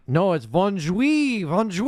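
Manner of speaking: high, slow prissy French correction; mock French accent. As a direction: correct him like a snobby French teacher. Correcting like the rude teacher.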